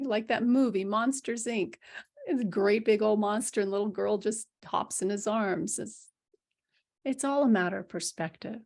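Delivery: normal talking voice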